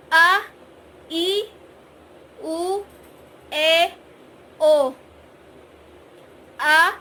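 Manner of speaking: slow vocal warm-up; slow vowel drill. Shown as a demonstration. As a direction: stretch each vowel with a pause. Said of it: "dengan kecepatan lambat".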